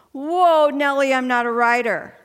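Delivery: loud, high Whoa, Nellie pulled back in alarm; startled protest. Retold or imitated. Speaker retelling her answer to God, loud startled protest. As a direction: yank the reins on the idea. Refusing God's call to write.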